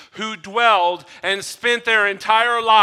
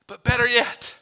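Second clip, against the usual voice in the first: ordinary delivery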